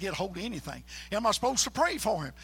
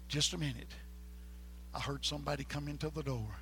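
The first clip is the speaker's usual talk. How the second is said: ordinary delivery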